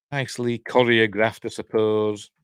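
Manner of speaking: ordinary delivery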